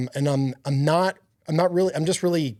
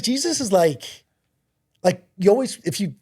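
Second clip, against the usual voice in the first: higher, eager pitch like selling a new coffee; retelling a buddy's words, raised and eager; excited buddy; 5 semitones higher than usual. Retold or imitated. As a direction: gush about it like a friend with a find. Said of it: "he's like".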